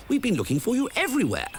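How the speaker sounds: professor voice. A narrator's reading. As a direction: hurry the words out as the professor. Professor voice continues.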